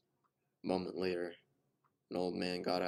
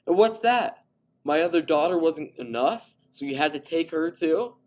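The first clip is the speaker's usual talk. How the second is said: much higher, much louder enraged yell; furious accusation; 10 semitones higher, 14 dB louder than usual. A narrator's reading. Father accusing the man over his daughters, furious yell. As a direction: hurl the accusation at him.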